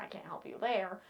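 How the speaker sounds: half-whispered apology; whispered sorry to viewers far away; whispered aside. Real, not acted. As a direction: breathe it out as a sorry little aside.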